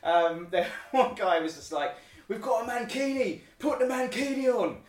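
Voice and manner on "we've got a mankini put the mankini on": high-pitched mock yell of a drunk lad; hammed-up heckle from a rowdy fan; drunk hooligan yelling. Retelling fans pressuring her to wear mankini, mock yell. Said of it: "absolutely yelling at you".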